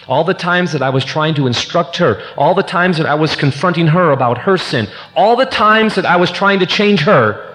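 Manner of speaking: impassioned list, rising in pitch and volume; building confession. Real, not acted. Husband owning up to trying to change his wife, building intensity.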